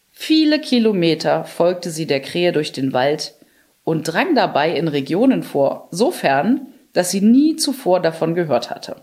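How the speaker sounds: ordinary delivery, nothing marked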